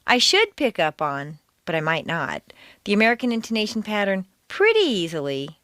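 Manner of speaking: SHOULD and PRETTY stressed with doubt; doubting Thomas. Shown as a demonstration. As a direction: sceptical emphasis on SHOULD, hedging as it falls. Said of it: "A doubting Thomas would show up with".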